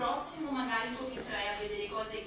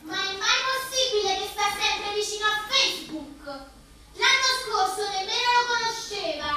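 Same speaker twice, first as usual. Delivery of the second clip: loud, higher exasperated complaint; 7 semitones higher, 8 dB louder than usual. Acted. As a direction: rant at the unfaithful husband. Suspicious wife complaining. (lamentela esasperata)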